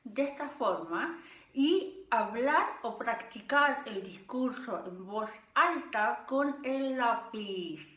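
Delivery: slow speech around a pen in the mouth; pen in teeth. Shown as a demonstration. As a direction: talk with a pencil between your teeth. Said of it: "ponerse el lápiz en la boca de esta forma".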